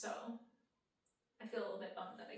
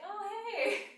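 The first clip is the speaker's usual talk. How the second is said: much higher, louder cheerful hey; excited greeting; 10 semitones higher, 8 dB louder than usual. Retold or imitated. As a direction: pop up high and loud like spotting a friend. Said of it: "I'm like".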